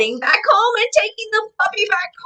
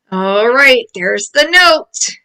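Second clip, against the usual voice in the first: ordinary delivery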